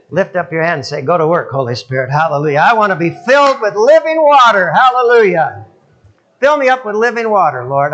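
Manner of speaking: loud rising prayer call; fervent congregational prayer; leading prayer. Acted. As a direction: call out to God with the whole room.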